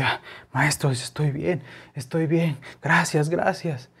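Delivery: the shaken driver thanking the truckers, whispered and slow; dazed whisper. Retold or imitated. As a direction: come round from shock and thank them faintly. Dazed driver after the slap.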